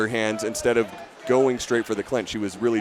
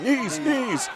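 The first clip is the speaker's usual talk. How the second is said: imitated yell; 11 semitones higher than usual. Commentator mimicking a fighter's corner, imitated high yell. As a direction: mimic a corner's shout in a much higher voice. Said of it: "you hear him yelling".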